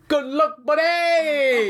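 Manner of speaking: very high, drawn-out mock shout; high-pitched, slow jeering send-off; audible taunting call. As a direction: imitate a player's taunt, stretching the words high. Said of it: "you can hear it audibly going".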